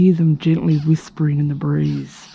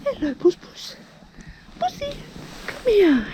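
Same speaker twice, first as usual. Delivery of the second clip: cooing to cat; 5 dB quieter than usual. Real, not acted. Woman calling a cat over, high cooing baby talk. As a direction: coo sweetly at the kitty.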